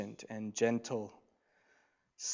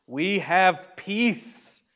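very slow, higher and louder "we have peace"; drawn out; 7 semitones higher, 8 dB louder, much slower than usual. Acted. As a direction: stretch out each word. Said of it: "slow emphasis".

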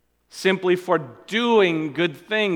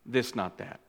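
hushed; 4 semitones lower, 10 dB quieter than usual. Acted. Preacher repeats contrast softly.

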